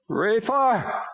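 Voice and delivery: high, loud demon cry. A narrator's reading. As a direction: call out the name like a demon. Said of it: "Bobby cried".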